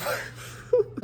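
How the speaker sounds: short high unvoiced giggle; a sudden breathy giggle; burst of laughter. Real, not acted. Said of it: "okay, I'm not laughing".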